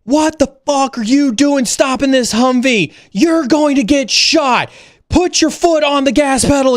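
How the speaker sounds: yelling at a stopped Humvee crew to drive on; retold yelling. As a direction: bark it up high like a frantic airman. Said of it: "I am yelling at them".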